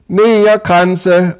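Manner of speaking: high, loud street-seller cry, slow; shouting. A narrator's reading. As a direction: call out wares to passers-by. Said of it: "råbte jeg".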